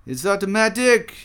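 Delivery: high, loud yelped quote. A narrator's reading. Buffon to Condillac about bees, yelping. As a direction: yelp it out, pitch jumping up. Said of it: "he yelps".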